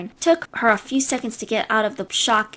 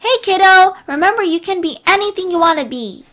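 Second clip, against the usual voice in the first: put-on high, loud call after the kid; yelling at the kid; 6 semitones higher, 5 dB louder than usual. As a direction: shout it after him as he leaves. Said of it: "Me, yelling at her kid".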